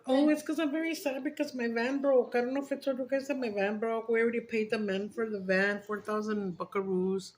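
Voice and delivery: ordinary delivery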